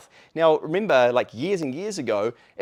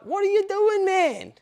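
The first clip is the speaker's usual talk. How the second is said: much higher, mock-baffled what are you doing man; incredulous reply; slower than usual. Replying to a rude commenter, high mock disbelief. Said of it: "You're like".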